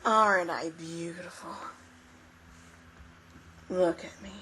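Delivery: whispered, sinister angel voice; hushed, creepy vanity; eerie angel. Acted. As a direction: whisper it slyly, as a vain angel. Game master voices vain angel.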